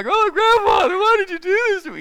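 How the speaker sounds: boy with horseradish up his nose, mock wailing at grandfather; mock crying wail. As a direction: wail like a kid with burning eyes, hammed up. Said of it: "And through the tears".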